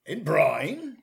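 mildly surprised echo; a touch of surprise. Real, not acted. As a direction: question it with a rising tune. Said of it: "an exclamation of surprise".

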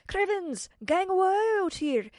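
high, loud Scottish shout, slowed; shouting wee man. Acted. Tiny kilted man shouting at Tiffany from his basket. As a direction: bellow it up from a tiny boat. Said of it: "he was waving a fist at her and shouting".

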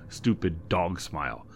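ordinary delivery, nothing marked

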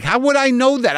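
very high-pitched retold indignation; indignant protest. Renter blamed by the clerk for the wrong place, indignant.

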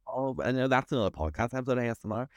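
normal talking voice

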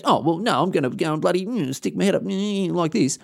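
high, hushed, whiny put-on sulk; imitating a grown man pouting like a child; mock sulking sook. Dad sulking at the kid in the car, high whiny mock sook. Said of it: "he's off crying and having a bloody big sook".

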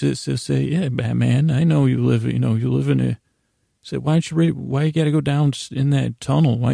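ordinary delivery

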